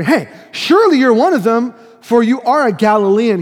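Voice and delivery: loud, pointed accusation; imitating a crowd's accusing call. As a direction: call him out in front of everyone.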